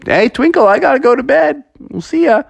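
calling out. Acted. Calling to sleeping friend.

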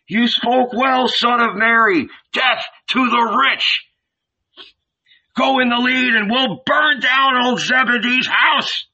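high-pitched rallying cry; shouted. A narrator's reading. Ragamuffins urge Jesus to lead them, shouted. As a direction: rouse the mob, pitched right up. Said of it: "they shouted".

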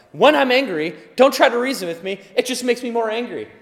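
husband warning his wife about his anger; heated warning. Retold or imitated. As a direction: tell the wife off with heat. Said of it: "I've told her, like".

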